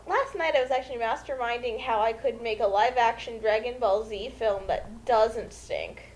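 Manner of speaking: ordinary delivery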